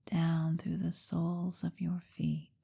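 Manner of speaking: hypnotherapist's measured, lulling delivery; guided hypnosis. Acted. Leads a guided hypnosis session.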